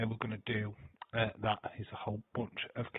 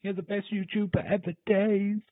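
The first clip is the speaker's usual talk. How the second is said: high, loud gushing fan voice; overexcited fan praise; mock fan gushing; 11 semitones higher, 8 dB louder than usual. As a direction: squeal it like an adoring fan. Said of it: "all it is is".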